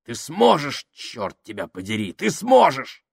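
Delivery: rising, high urging cry; urging encouragement. A narrator's reading. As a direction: drive him on with force. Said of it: "подбодрил Фабрини". (настойчиво подбадривая)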